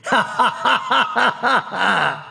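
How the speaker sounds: high, gloating villain laugh; evil cackle. Acted. Scientist welcoming Aerith back to the fold, evil cackle. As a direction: cackle in triumph. Scientist greets returned captive.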